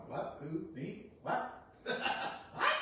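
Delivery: startled, disbelieving questions ending in a squeaky high cry; mock bewildered denial. Self caught in the mirror, feigning shock. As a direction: play dumb, as if caught in the mirror. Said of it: "There's someone going".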